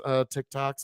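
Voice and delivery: usual speaking voice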